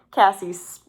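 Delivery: ordinary delivery, nothing marked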